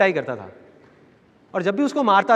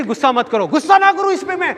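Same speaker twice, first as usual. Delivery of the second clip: much higher and louder, bursting into a beating; father shouting at his son while hitting him; furious father; 8 semitones higher, 4 dB louder than usual. Retold or imitated. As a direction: yell it like a dad losing his temper mid-swing.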